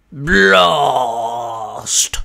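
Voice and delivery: low, loud monster cry; creature yell. A narrator's reading. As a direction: bellow like a big water turtle. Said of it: "His Blastoise yelled out".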